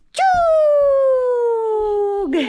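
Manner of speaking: high loud cheer; sudden high shout of encouragement; excited cheer. Real, not acted. Mother cheering toddler.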